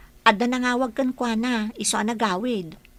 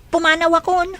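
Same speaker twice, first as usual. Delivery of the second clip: loud retort; 6 semitones higher, 9 dB louder than usual. Acted. Maid leaving in protest.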